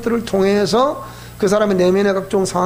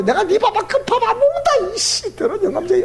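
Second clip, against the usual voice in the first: much higher cursing at husband; imitating grandma's venting yell; mock furious yell; 10 semitones higher than usual. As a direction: scream curses into the valley. Grandmother yelling in valley.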